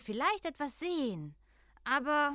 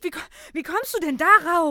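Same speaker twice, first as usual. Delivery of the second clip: put-on loud indignant child; 5 semitones higher, 11 dB louder, faster than usual. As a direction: protest it loudly. Children quarrel loudly.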